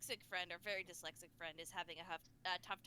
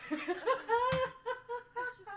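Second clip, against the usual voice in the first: laughing; 13 semitones higher, 11 dB louder than usual. Real, not acted.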